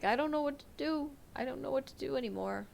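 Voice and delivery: teary quote. Retold or imitated. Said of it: "talking between crying".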